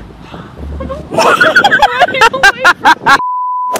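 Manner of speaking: very high, crying laughter; tearful high laugh. Real, not acted. Woman overwhelmed by a llama, tearful high laugh. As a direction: laugh until it turns to crying.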